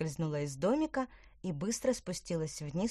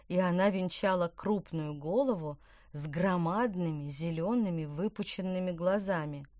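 ordinary delivery, nothing marked

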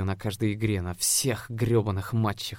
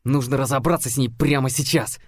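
louder, tense menacing hiss; hissed threat; 4 semitones higher, 6 dB louder than usual. A narrator's reading. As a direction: spit out the threat at the cheerleader. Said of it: "прошипел он".

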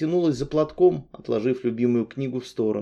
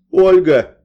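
exclaimed name; 7 semitones higher, 11 dB louder than usual. A narrator's reading. Blurting out the name.

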